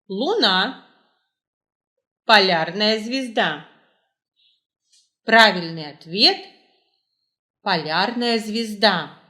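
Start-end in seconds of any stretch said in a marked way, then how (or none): none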